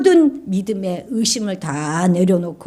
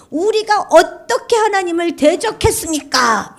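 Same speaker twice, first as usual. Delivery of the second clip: defiant retort; 10 semitones higher, 4 dB louder than usual. Acted. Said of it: "이렇게 대들고 있는".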